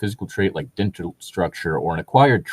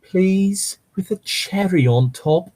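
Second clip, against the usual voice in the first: high, drawn-out pleading please; childish begging; 10 semitones higher, slower than usual. Pretend. [name] begging Harry with puppy eyes, high childish plea. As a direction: beg like a kid with puppy eyes.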